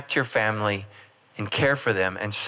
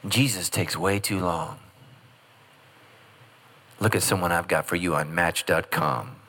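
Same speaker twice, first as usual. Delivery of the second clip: devil's tempting voice; 7 semitones lower than usual. Retold or imitated. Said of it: "You know what the devil's saying?".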